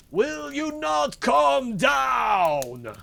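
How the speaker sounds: slow, high, drawn-out bellow; angry shout. A narrator's reading. Bluebeard demanding his wife come down, drawn-out furious shout. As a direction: roar it, stretching each word. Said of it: "cried Bluebeard".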